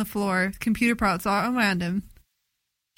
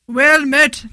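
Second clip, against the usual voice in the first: loud, exaggerated superhero voice; Batman; 4 semitones higher, 9 dB louder than usual. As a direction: boom it out, far too heavy for a greeting. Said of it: "Not in the Batman voice".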